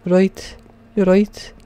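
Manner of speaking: high-pitched mock Scouse; put-on Liverpool-like accent. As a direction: try out a hammy Liverpudlian greeting. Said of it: "Is that Liv-, Liverpool".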